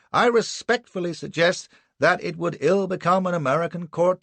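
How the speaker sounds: insistent defence counsel. A narrator's reading. Anderson argues British rights to the court, insistent. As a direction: plead the captain's rights with full courtroom force.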